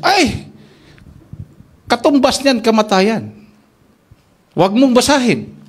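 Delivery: mock-alarmed pastor. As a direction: cry out in alarm, then warn.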